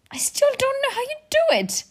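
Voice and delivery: very high, effortful exclamation; strained bafflement. Acted. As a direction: squeeze it out while straining. Clint strains at immovable hammer.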